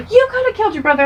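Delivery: high, dramatic mock horror; freaking-out mom. Mom accusing child, freaking out. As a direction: gasp out the accusation. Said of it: "My mom is freaking out".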